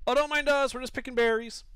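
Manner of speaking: loud, high kobold calling out; high-pitched creature voice. Acted. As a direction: pipe up from a hiding place, feigning innocence. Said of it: "a somewhat high-pitched voice call out".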